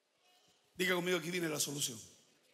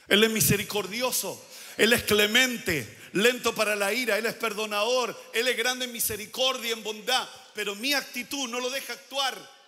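loud, emphatic preacher listing virtues; preaching; 7 dB louder than usual. Real, not acted. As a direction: proclaim each quality forcefully. Preaches God's mercy at full force.

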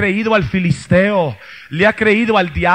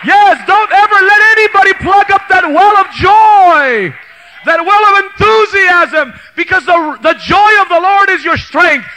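loud, high, fervent sermon shout; preacher rousing the youth camp, shouting praise; full-force preaching; 6 semitones higher, 8 dB louder than usual. Acted. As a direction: preach it with everything you have.

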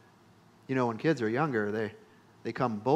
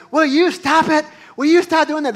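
much higher, louder exasperated mother voice; exasperated mom; 14 semitones higher, 12 dB louder than usual. Retold or imitated. Mother scolding her son to stop the fake yelling, exasperated. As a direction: play the fed-up mom shouting him down. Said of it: "my mom would be like".

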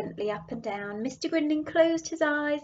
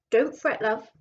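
raised voice calling down a phone line; shouted down the phone; 5 dB louder than usual. Acted. Coast guard calling down the phone to a worried wife, raised voice. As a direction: call out reassuringly over the phone. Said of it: "he shouted down the phone".

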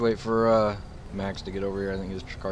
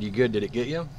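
repeated louder so the partner hears; 4 semitones higher, 4 dB louder than usual. Real, not acted. Officer repeats the question louder to his partner. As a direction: raise the voice a notch and ask again. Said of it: "The what?".

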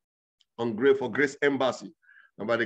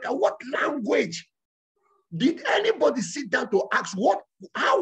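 rising higher toward a shouted question; preaching build; 7 semitones higher than usual. Real, not acted. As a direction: press the congregation harder each question.

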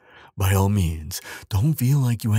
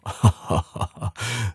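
fond chuckle; 3 semitones higher than usual. Acted. Giant delighted by the freshly bathed human, fond chuckle. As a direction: laugh tenderly at how cute they are.

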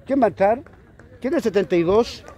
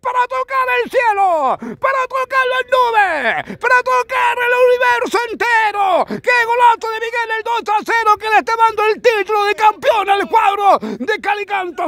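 long, high, stretched goal yell; goal commentary shout. Real, not acted. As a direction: scream the goal to the skies.

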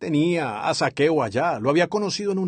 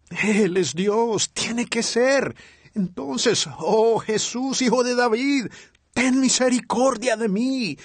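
crying out; 5 semitones higher, slower than usual. Retold or imitated. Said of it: "él clamó".

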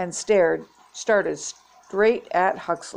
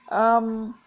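higher-pitched, hesitant um; stammering; 4 semitones higher than usual. A narrator's reading. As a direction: stall nervously on a drawn um. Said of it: "she stammered".

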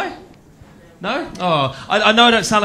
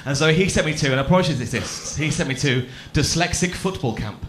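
normal talking voice

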